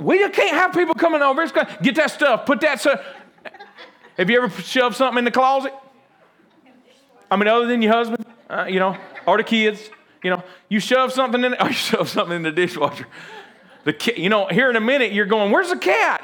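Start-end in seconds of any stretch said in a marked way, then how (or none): 0.0-3.0 high-pitched flustered housewife; hammed-up frantic scramble, pitched way up; mock panic. Acted panicking wife before guests arrive. 15.5-16.2 high, worried calling out; hammed-up search for a missing pet; mock alarm. Acted search for missing cat.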